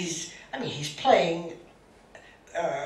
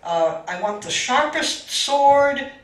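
stern abbot orders; 9 dB louder than usual. Retold or imitated. Abbot gives firm orders.

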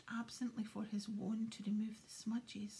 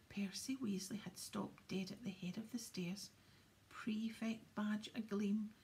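usual speaking voice

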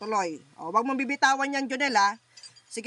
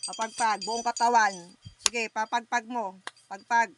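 normal talking voice

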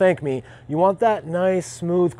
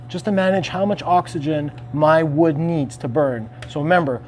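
ordinary talk

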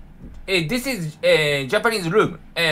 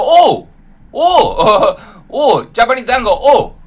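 delighted cries; 4 semitones higher, 7 dB louder than usual. Real, not acted. Tasting dango, delighted cries.

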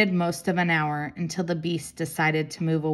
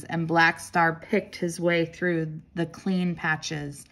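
plain reading voice

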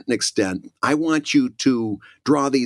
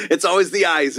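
laughing; 5 semitones higher than usual. Real, not acted.

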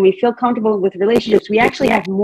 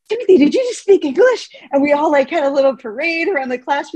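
बहुत ऊँची, उत्साही आवाज़ में पुकार; high-pitched excited calling out to the boy; excited cheer; 7 semitones higher than usual. Retold or imitated. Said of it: "everyone's like".

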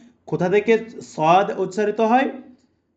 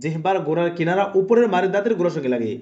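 ordinary talk, nothing marked

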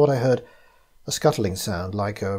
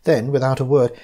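usual speaking voice

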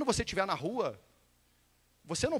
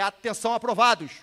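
firm, louder attention call; command voice; 6 dB louder than usual. Shown as a demonstration. Instructor repeats his call to the approved candidates, command voice. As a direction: summon everyone's attention before an order. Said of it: "por isso que eu falei".

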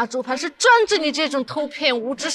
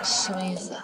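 whispering; 6 semitones lower, 7 dB quieter, faster than usual. Acted. Shy reply to a suggestive line.

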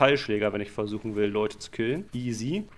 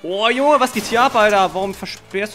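high, loud astonished exclamation; surprised outburst; 8 semitones higher, 7 dB louder than usual. Real, not acted. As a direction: react in shock to the roadblock. Sees a blocked road. (lauter, hoher Ausruf der Überraschung)

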